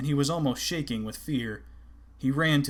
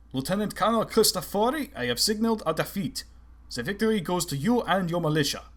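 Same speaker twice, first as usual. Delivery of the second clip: Blucher's voice; 6 semitones higher than usual. A narrator's reading.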